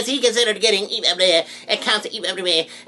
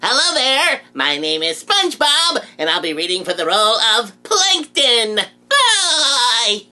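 SpongeBob voice; slower than usual. Acted. SpongeBob voice audition.

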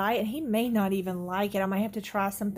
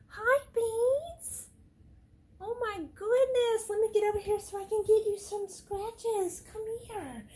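high cooing pet voice, much higher; 12 semitones higher, 3 dB louder than usual. Real, not acted. Greeting sick cat, pet voice.

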